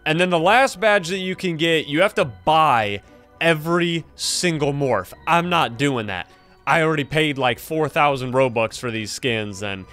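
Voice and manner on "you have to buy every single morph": ordinary delivery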